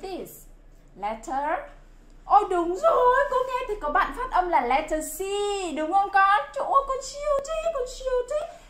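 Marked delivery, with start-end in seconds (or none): 2.2-8.6 delighted teacher praise; excited praise. Real, not acted.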